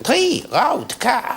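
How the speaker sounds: very high, very slow alien croak. Retold or imitated. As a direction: squeak it out like a fat alien learning English. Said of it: "and went".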